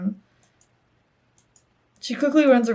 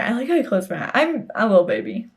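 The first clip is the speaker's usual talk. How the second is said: ordinary talk, nothing marked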